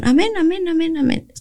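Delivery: imitating the group's eager amen. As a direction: toss out each amen with eager zeal. Group answering every order eagerly.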